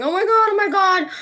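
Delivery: high, loud wailing complaint; crying. Retold or imitated. Calling Glenn in tears about the birthday cake. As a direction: wail it like fresh tears on the phone. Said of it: "calling Glenn crying".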